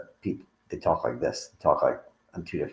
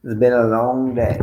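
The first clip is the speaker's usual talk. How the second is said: drawn-out, slow words stretched by a real yawn; talking through a yawn; 6 semitones higher, 8 dB louder than usual. Real, not acted. Tired host to livestream viewers, yawning through words. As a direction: let the yawn pull the words long and loud. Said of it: "I yawn on camera".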